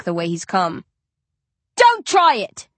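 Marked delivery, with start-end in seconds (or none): 1.8-2.7 high, loud warning shout. Acted. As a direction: bark it as a threat. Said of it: "I shout".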